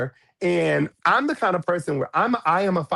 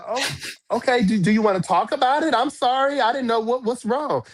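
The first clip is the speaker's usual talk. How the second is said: much higher, coaxing apology; apologetic soothing; 9 semitones higher than usual. Retold or imitated. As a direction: gently try to make peace. Apologetic, trying to soothe Barbie.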